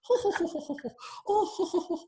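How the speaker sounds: high, prim royal titter; Queen laugh. Shown as a demonstration. Host demonstrating the Queen's laugh for the group. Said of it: "like the Queen laugh".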